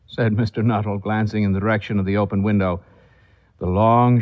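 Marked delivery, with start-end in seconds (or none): none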